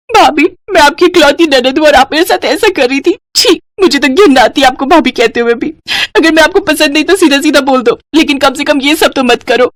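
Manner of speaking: high, upset sister-in-law voice; high-pitched hurt and disgust; wounded accusation. Acted. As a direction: play the injured victim. Wronged sister-in-law tearfully accuses.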